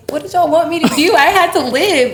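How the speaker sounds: high, loud protest, retelling; exasperated retort. Retold or imitated. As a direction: throw it back at them, loudly exasperated. Retold exasperated retort to admirers.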